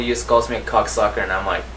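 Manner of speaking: ordinary talk, nothing marked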